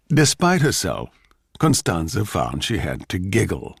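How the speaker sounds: ordinary delivery, nothing marked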